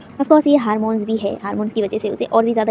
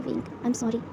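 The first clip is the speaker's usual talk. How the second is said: tender apology; 9 dB quieter, slower than usual. A narrator's reading. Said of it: "किस करते हुए कहता है".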